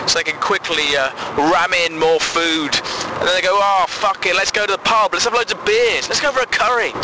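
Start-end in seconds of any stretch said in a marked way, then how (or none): 3.6-6.9 giddy binge excuse. Retold or imitated. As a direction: pitch climbing higher, gleeful and reckless. Mocking dieters giving up.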